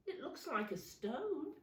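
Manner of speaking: ordinary delivery, nothing marked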